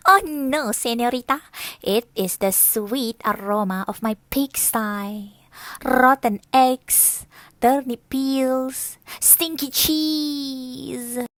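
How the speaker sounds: loud, savoring pig voice; proud pig. Acted. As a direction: relish every stinky word. Pig proudly describes smell.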